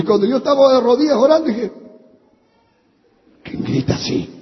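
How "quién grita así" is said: low, hushed, voiceless mutter; whispered aside. Retold or imitated. Husband puzzled by wife's crying as he prays, whispering. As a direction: whisper it privately under your breath. His muttered reaction during her wailing.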